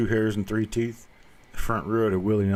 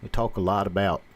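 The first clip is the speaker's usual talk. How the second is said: Appalachian accent. Shown as a demonstration. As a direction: talk it like a mountain man. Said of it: "Kentucky accent".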